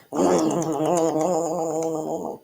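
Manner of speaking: happy eating mmm; pretend eating hum. Shown as a demonstration. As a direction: hum like tasting food. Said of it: "show me how you would eat".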